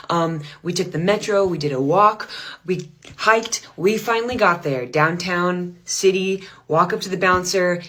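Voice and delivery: ordinary delivery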